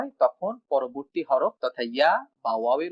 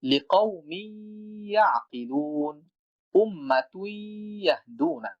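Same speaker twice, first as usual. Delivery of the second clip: slow, measured tajweed recitation; Quran recitation; 3 semitones higher, much slower than usual. Shown as a demonstration. Teacher recites a Quran example in tajweed style. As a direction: chant the verse slowly.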